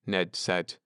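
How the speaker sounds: ordinary delivery, nothing marked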